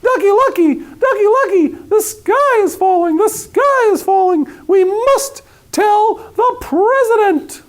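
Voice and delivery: high, slow squawking chicken panic; clucking hen. A narrator's reading. Hen clucking her alarm to the world, high and frantic. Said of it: "and clucked".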